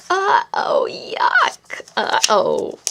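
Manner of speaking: exaggerated surprise voice; big uh-oh. Shown as a demonstration. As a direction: squeal it big for the toddler. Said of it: "saying over and over again".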